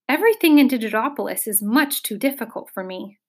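usual speaking voice

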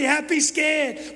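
giddy and jittery at once; nervous glee. Acted. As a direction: stretch it like a giddy gulp. Repeats his feeling, performed.

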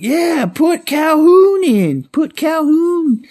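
very high, drawn-out holler; second fan joining in. Retold or imitated. Another person in the stadium gets on it, high-pitched drawn-out yell. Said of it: "another person gets on it".

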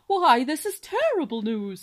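put-on loud, grand, pompous old swan voice; pompous cob swan. Father swan booming his alarm to his wife. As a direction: boom it out like a self-important father swan.

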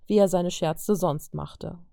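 ordinary delivery

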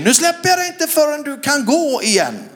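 high, loud desperate cry; retold shout. Preacher shouting to the woman before the stadium crowd, high and loud. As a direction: belt the vow out into the mic. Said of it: "så skriker jag i micken".

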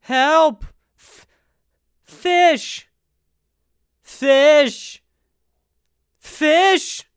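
high, loud desperate calls; mock shouting for help. Camper screaming for host in the dark, loud. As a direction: yell for help into the night. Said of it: "I'm not gonna be shouting".

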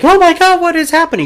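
high panicked shriek of a scared crowd. Acted. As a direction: squeal it like a terrified bystander.

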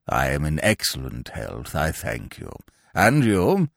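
low, slow and stiff, a stuffy peer; pompous old lord. Acted. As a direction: speak down from great dignity, deep and measured.

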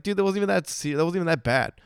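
high-voiced mock objection; mock protest. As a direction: push back like a heckler defending a joke. Acted reply to player's reproach.

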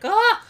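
short, high, loud comic yelp; mock scream of terror. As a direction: imitate a terrified onlooker's scream. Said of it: "like, in terror".

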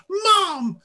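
high, loud sing-song yell; yelling a family name like a kids' show; show intro yell. Retold or imitated. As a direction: belt it out like the cartoon intro. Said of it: "I yelled it like that in that kind of voice".

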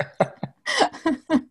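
high-pitched amused laugh; real laugh. Real, not acted. Speaker finishes anecdote about mum not understanding, high laugh. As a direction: laugh at your own story.

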